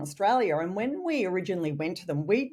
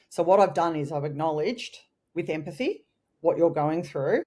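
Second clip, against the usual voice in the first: usual speaking voice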